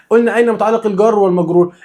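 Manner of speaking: ordinary delivery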